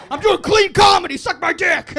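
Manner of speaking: loud, high mock-aggressive yell; defiant shout. Comic announcing clean comedy, defiantly shouted. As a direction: yell it at the whole room.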